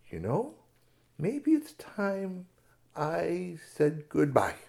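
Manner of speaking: very slow, drawn out words. Acted. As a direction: ease out of it slowly. Quietly deciding to leave.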